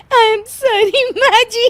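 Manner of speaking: high, slow apology dissolving into giggles; laughing apology. Acted. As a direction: try to say sorry but giggle. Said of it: "फिर से हंस रही है".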